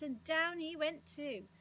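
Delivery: plain reading voice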